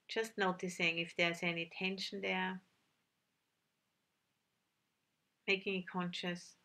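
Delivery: calm meditation guide with long, unhurried pauses; measured, settled voice, leaving silence between instructions; guided meditation. Real, not acted. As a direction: gently lead sitters inward, phrase by phrase.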